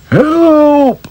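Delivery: high, loud cry from below; calling for help. Acted. Trapped farmer calling up the shaft, loud. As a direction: call out up the shaft. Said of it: "called Farmer Brown".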